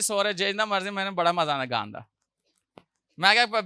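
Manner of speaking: ordinary delivery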